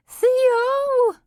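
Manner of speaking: high, loud wailing cry of a name; distressed wail. A narrator's reading. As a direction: wail it out like a banshee. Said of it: "let out a loud, distressed wail".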